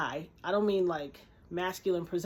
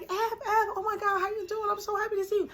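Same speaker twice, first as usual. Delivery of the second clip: very high, gushing hello, acted out; squeal-like delight at spotting an old friend; imitated thrilled greeting; 14 semitones higher, 4 dB louder than usual. Young woman greets her joyfully.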